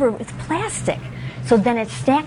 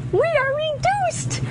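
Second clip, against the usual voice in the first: high squeaky tiny voice; a small, high voice. Shown as a demonstration. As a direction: make yourself sound very small. Tiny voice after shrinking.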